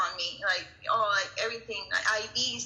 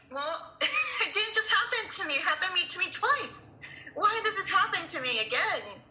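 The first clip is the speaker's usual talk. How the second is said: much higher, pained and bitter with a broken laugh; anguished high protest; 9 semitones higher than usual. Retold or imitated. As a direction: let the hurt climb up high. Inner outburst after husband's reassurance.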